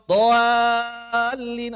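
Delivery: doalin drawn out slowly with a thick alif after dod; heavy long alif. Shown as a demonstration. Teacher demonstrates a tajwid example, slow and heavy. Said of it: "Kalau dod, do".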